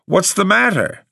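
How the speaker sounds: high-pitched irritated outburst; irritable cry. A narrator's reading. New tenant to the staring caretaker, irritable cry. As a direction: demand it irritably, pitch jumping right up. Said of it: "I cried irritably".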